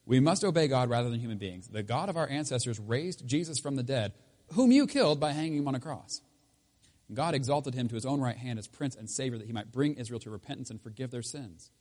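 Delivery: reading scripture with rising force, loudest on the killing. Acted. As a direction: read it as a bold defence. Reads the apostles' answer aloud.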